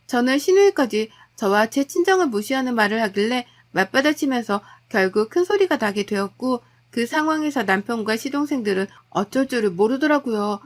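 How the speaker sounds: ordinary delivery, nothing marked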